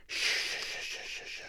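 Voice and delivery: soft unvoiced shushing like wind in leaves; hushed shh of blowing leaves; leaves rustling.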